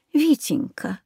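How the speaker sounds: softly, almost breathing the name; tender, quiet. A narrator's reading. Mother comforts her son, tenderly and quietly. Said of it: "тихо ответила мама".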